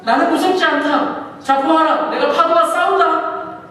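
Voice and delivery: loud, high booming scolding; loud, high mock Jesus scolding the faithless; stern rebuke. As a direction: rebuke them like a bold commander. Jesus voiced rebuking his disciples.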